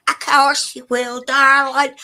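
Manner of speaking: put-on Southern accent. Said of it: "may I do your voice in Southern?".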